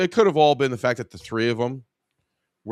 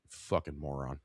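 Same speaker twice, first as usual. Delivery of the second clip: low, much softer muttered insult; 9 semitones lower, 13 dB quieter than usual. Acted. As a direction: mutter it almost under your breath, low.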